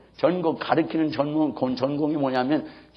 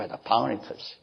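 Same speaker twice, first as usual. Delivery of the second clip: ordinary talk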